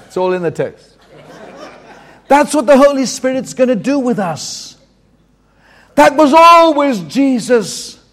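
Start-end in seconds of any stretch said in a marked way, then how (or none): none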